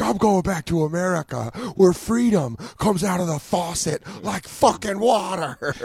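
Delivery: high, grandiose heel promo; hammed-up patriot villain, stretching the finish; wrestling heel. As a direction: rile the crowd with over-the-top American bragging. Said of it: "start being real heel".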